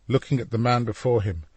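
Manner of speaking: plain reading voice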